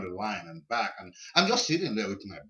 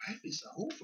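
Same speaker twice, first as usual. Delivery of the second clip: incredulous echo; 14 semitones higher, 9 dB quieter, faster than usual. Retold or imitated. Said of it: "And he's like".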